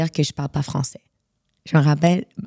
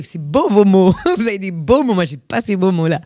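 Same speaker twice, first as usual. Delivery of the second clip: laughing mock praise; 3 semitones higher, 6 dB louder than usual. Teasing the French about their beautiful words, laughing. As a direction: gush over their pretty words, laughing.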